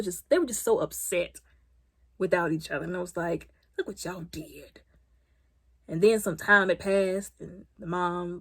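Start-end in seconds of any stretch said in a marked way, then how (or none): 3.8-4.8 slow hushed scolding; soft slow whisper; slow whispered reproach. Real, not acted. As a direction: whisper it slowly in disapproval.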